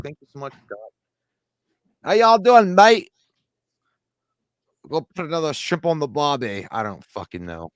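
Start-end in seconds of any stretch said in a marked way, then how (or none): 2.0-6.7 loud, high-pitched hammed-up Aussie accent; badly faked Aussie greeting; mock Australian accent. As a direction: call out like a cartoon Australian. Shout-out for Aussie fans, mock accent.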